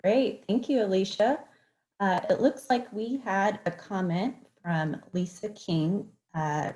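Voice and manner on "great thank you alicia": ordinary delivery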